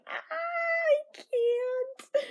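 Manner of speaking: high, strained crying whine; pained whine. Real, not acted. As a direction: whine like it physically hurts. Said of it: "curling up tighter and tighter".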